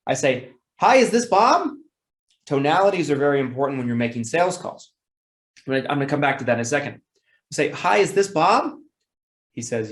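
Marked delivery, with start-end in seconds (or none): 0.8-1.7 bright, high, eager phone hello; enthusiastic greeting. Shown as a demonstration. Salesman opening a call to Bob, high and enthusiastic. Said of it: "starting out the conversation with a high tone". 7.7-8.7 upbeat, high, slower phone hello; enthusiastic greeting. Shown as a demonstration. Salesman greeting Bob on the phone, bright and eager. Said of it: "an excited tone, an enthusiastic tone".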